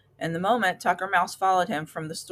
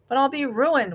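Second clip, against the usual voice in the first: whimpering; 7 semitones higher than usual. A narrator's reading. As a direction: wail it like a miser losing everything. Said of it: "whimpered Tucker".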